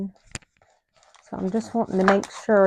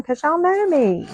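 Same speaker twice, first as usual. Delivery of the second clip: high playful squeal; 10 semitones higher, 5 dB louder than usual. Real, not acted. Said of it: "self-teasing remark".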